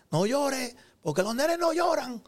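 a hint of an old-school parent telling a boy off; stern elder voice. Elder scolding a crying boy, lightly voiced stern. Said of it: "la palabra principal que yo escuché".

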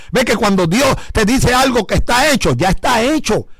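ordinary talk

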